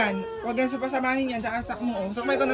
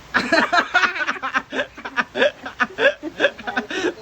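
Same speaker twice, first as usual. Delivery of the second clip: long, higher-pitched burst of laughter; hearty laugh; 5 semitones higher than usual. Real, not acted. Vlogger hears child boast of much money, long laugh.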